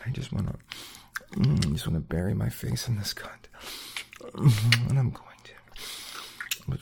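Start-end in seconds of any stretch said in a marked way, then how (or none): 0.0-3.3 breathy intimate whisper, quick. Acted. To his partner, hungry whisper. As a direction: lean in and whisper the want.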